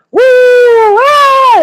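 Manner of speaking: imitated fairground squeals, very high and loud; long high "woo" of distant riders having fun; high crowd scream. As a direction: whoop like a thrilled fairgoer. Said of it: "You can hear the".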